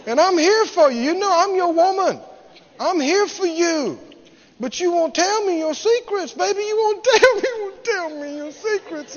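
high, whining plea breaking up at the end; hammed-up tearful wheedling; mock crying woman. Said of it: "And she'd cry".

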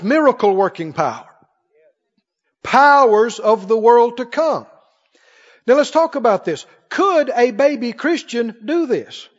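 normal talking voice

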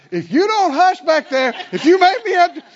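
loud, high angry parent; fed-up parent shouting from the front seat; parent's threat. Retold or imitated. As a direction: yell it at the kids in the back. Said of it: "The response is".

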